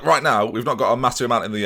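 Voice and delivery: ordinary delivery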